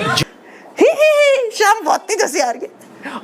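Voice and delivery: squeaky held-in "ही, ही" then strained "शाम बहुत तेज हंसी आ रही है"; hammed-up stifled laughter; suppressed giggle. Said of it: "मतलब इतना नाटक करते हैं ना". (दबी हुई नकली हंसी)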